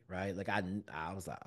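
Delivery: usual speaking voice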